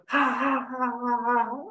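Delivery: ha ha ha like a canned studio audience; mocking a hollow Hollywood laugh; fake TV laugh. Shown as a demonstration. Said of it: "like they do on television talk shows".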